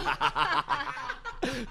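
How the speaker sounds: high, loud burst of laughter. Real, not acted. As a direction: crack up at the slip. Laughs at the slip.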